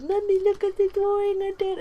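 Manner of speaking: high-pitched mock child voice; little kid. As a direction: pipe up like a small child showing off to Mummy. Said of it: "it's the little kid's fault when he goes".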